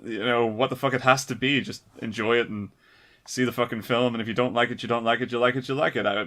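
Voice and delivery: normal talking voice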